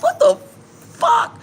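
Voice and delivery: high, loud mock shock; shocked outburst. Retelling her reaction to Gino's food, shocked outburst. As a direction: blurt it out in disbelief. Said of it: "I'm like".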